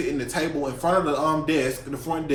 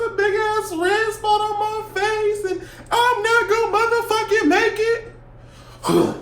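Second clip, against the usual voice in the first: very high, slow, stretched-out wail; high squeal; 3 dB louder, slower than usual. Real, not acted. Telling her story, squeals in despair. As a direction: squeal it out like it's the end of the world.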